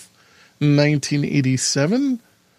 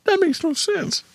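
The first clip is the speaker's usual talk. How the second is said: high incredulous voice; squeaky baffled dad; mock confusion. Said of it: "Robert Culp wants to look at him and go".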